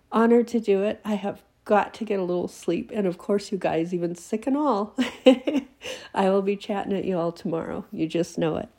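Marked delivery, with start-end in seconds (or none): 5.0-6.1 short high chuckle; a quick giggle to oneself; light laugh. Real, not acted. Speaker signing off to listeners while sick, light chuckle.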